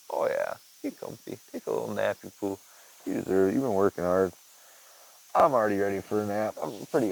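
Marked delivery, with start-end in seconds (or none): 0.1-2.6 hushed pet talk; soft whispery coo to a cat. Real, not acted. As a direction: coax the cat to nap softly. Cooing cat to sleep.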